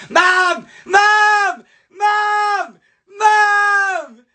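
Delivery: very high, drawn-out loud yells; yelling for Mum. Retold or imitated. Boy tattling on his brother, high yell for Mum. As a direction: holler for your mother over and over. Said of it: "and I yelled".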